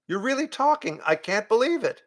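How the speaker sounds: put-on amazed boy. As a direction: gasp it like a stunned kid. James amazed.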